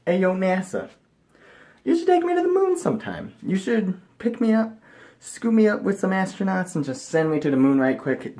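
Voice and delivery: high, playful appeal to NASA; cheeky pitch. Pretend.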